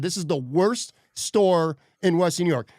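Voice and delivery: ordinary talk, nothing marked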